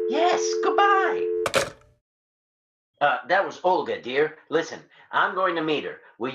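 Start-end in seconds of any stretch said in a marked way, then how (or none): 0.1-1.2 high sing-out goodbye; phone sign-off. Acted. Signs off the phone call.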